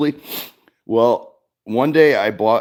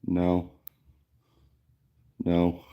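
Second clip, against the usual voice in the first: quiet flat deadpan no; calm, softer, stunned refusal; calmly; 4 semitones lower, 7 dB quieter than usual. Retold or imitated. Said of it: "I just calmly am like".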